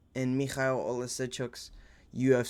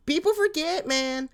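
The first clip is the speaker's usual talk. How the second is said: much higher squeaky voice, hyped; excited squeal; 14 semitones higher than usual. Pretend. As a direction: jump way up into a squeaky hype voice. Excited exclamation in high voice.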